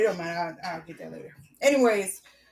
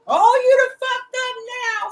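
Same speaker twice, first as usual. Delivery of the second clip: much higher, put-on voice of a threatening old man; mocking old man; 11 semitones higher, 4 dB louder than usual. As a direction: gloat like the neighbour.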